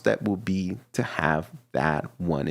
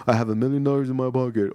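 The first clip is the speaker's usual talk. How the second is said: mock confident boast; 3 semitones higher, much faster than usual. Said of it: "I said it like I have it right here in my pocket".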